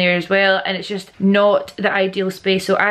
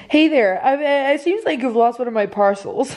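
hammed-up American advert voice; advertorial American; 5 semitones higher than usual. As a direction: put on a chirpy American ad-read accent. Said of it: "advertorial American".

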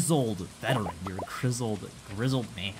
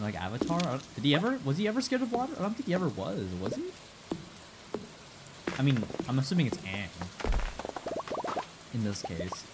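ordinary talk, nothing marked

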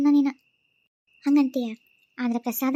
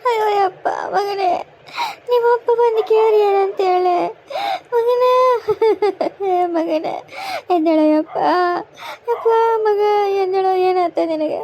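loud, high keening over the son; grief wailing; 8 semitones higher, 6 dB louder than usual. Acted. Mother begs her lifeless son to wake, wailing loudly. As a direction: beg the boy to get up, sobbing.

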